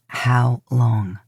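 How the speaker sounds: low, insistent repeat; lightly voiced impatient brother; hint of a gruff hunter. Dean repeats demand, lower.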